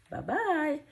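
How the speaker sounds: ordinary delivery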